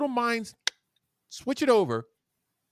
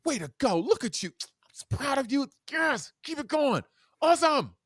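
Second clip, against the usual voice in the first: much higher, excited cheering; hyped-up praise; 12 semitones higher than usual. Shown as a demonstration. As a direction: cheer a student on. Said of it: "Student does something ... good".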